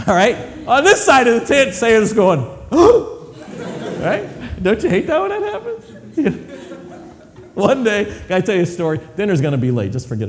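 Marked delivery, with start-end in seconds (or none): 2.7-7.5 laughing gasp. Real, not acted. Caught eavesdropping Sarah.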